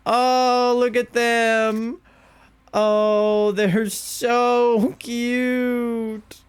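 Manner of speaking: high, very slow doting coo; drawn-out cooing. Real, not acted. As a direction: stretch out the cute words. Gushing over the pair.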